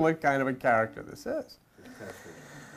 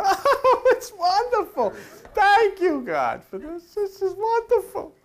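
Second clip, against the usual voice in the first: much higher, slow, laughing woman's voice; gushing Sarah; 12 semitones higher, slower than usual. Acted. Sarah thanks God for the baby, high and gushing. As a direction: ham up a delighted old woman.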